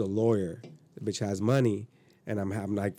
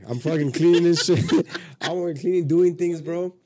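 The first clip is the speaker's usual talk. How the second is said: laughing; 6 semitones higher, 6 dB louder than usual. Real, not acted. Laughing at his own story.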